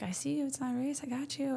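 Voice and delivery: high, gentle voice imitating Jesus; tender reassurance. Jesus meeting us on the rock, tenderly reassuring. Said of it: "is so tender to meet us".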